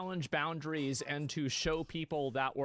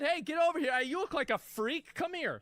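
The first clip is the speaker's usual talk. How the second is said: mocking sneer; 11 semitones higher, 4 dB louder than usual. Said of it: "You said".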